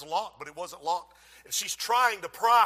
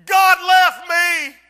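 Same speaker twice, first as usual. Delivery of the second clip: put-on girl warning the preacher to tell America; desperate warning cry; 8 semitones higher, 12 dB louder than usual. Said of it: "She said".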